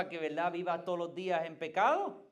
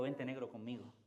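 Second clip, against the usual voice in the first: tender voice of God; 7 semitones lower, 7 dB quieter, faster than usual. Retold or imitated. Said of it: "Dios te separó y te dijo".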